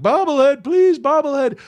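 high, eager pleading cry; shameless begging. Retold or imitated. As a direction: re-enact begging for a prize. Begging a rider for a souvenir.

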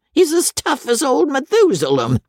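high, coarse squire jibe; mock it like a crude old lord; jeering old baronet. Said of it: "Sir Pitt mocking his brother".

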